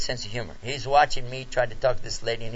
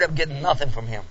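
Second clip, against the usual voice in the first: normal talking voice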